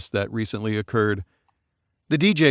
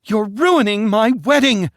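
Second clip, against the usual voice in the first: much higher, louder desperate cry; 11 semitones higher, 5 dB louder than usual. Acted. Bride crying out at fighting parents, desperate. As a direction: beg them to stop at full voice. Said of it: "Leslie cried out".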